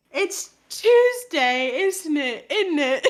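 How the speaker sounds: slow, hammed-up British; put-on British, tipping into laughter; British accent. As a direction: read it out in mock colloquial British. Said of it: "this is my customer service British voice".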